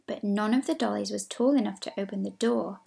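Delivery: ordinary delivery, nothing marked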